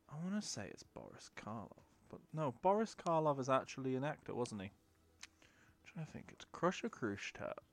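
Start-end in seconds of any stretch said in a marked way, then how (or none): 4.7-6.4 whisper. Real, not acted. Muttering to self.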